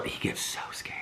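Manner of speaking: soft low whisper. Real, not acted. Whispering to viewers about the scared puppy. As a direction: breathe it out gently, as if not to frighten him. Telling how the puppy fears barking.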